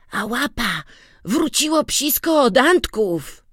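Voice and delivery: put-on boy's eager voice. A narrator's reading. Boy answers the sick man.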